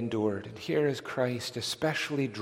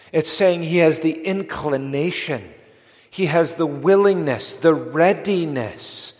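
rising, louder preacher's push; fervent preaching; 3 semitones higher, 5 dB louder than usual. Real, not acted. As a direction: press the point home from the pulpit. Preacher building fervour.

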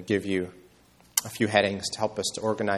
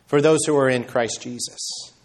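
normal talking voice

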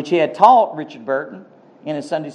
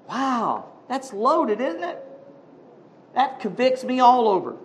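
normal talking voice

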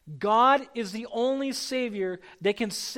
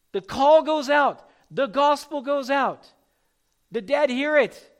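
loud, proclaiming the call; preaching at full force; 3 semitones higher, 7 dB louder than usual. Acted. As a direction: announce it to the whole room.

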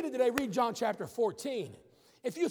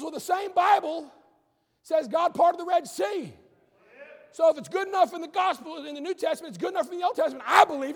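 higher and louder, emphatic; bold declaration; 6 semitones higher, 7 dB louder than usual. Retold or imitated. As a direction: stand up and declare it. Jock's bold reply to scholars.

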